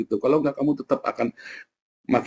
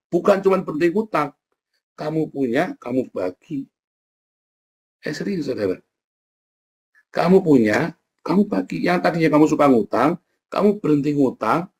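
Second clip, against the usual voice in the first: ordinary talk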